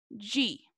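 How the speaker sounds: "di" pronounced "djee"; Brazilian di sound. Shown as a demonstration. Teacher giving the rule for DI, pronounced "djee". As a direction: model "di" with a j-like d. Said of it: "siempre se va a pronunciar como".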